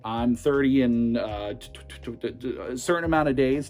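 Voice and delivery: ordinary talk, nothing marked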